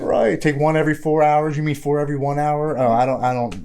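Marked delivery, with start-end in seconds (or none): 0.4-2.8 louder, higher, hammed-up joke; louder mock reading, then the punchline; joking mock instructions. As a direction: play it up for the laugh. Joking about the dosage instructions.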